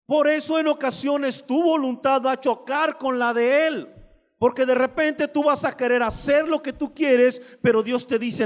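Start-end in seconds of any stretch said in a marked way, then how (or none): none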